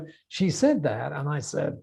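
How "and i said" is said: usual speaking voice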